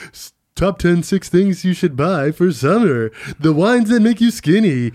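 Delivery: mock breakfast TV announcer, drawn out; mock morning-show host. Mocking GMA fluff segments.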